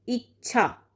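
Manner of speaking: usual speaking voice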